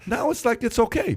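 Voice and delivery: hammed-up joking skit voice; high playful voice.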